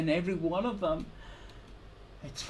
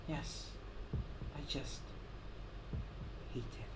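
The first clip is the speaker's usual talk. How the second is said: much softer, almost a breath; faint whisper; 2 semitones lower, 12 dB quieter than usual. Acted. Victim admitting his hatred in a whisper. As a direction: let it fade to nothing. Quiet bitter admission.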